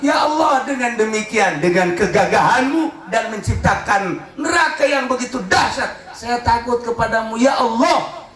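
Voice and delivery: high-pitched, fervent cry to God; fearful pleading prayer. Acted. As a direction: plead in dread of hellfire, drawing out key words. Impassioned prayer, fear of hell.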